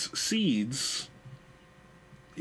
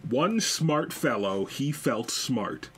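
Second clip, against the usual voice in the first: slow careful twister; much slower than usual. Shown as a demonstration. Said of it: "tongue twister demo".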